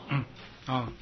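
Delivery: ordinary talk, nothing marked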